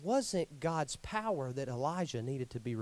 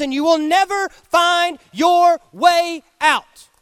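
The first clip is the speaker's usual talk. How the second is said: slow, rising to a much louder shout; building preaching; 8 semitones higher, 14 dB louder than usual. Acted. Preacher warning of darkness in the cave, building to a shout. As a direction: build each line louder and slower.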